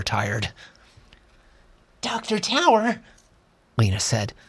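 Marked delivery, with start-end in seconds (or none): 2.0-3.0 put-on high girl calling out the name "Doctor Tower"; the girl says "Doctor Tower" high and urgent; young girl voice. Lena calls the doctor.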